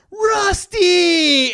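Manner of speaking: high, drawn-out imitated shout; calling out. Buddy calls out to dog.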